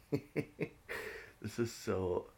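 laughing. Real, not acted. Introducing a ridiculous topic, laughing through the words. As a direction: laugh it out slowly.

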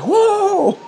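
high grunting yell; screaming. Retold or imitated. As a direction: bellow it mid-lift.

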